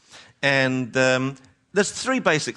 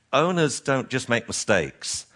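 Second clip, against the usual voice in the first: usual speaking voice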